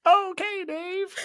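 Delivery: very high squeaky voice; chipmunk voice. Pretend. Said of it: "You should have just been like".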